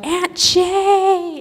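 put-on high, bright call of a little girl; child calling out. A little niece running to her aunt, calling out her name. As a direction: call out like an excited small child.

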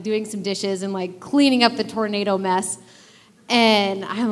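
ordinary talk, nothing marked

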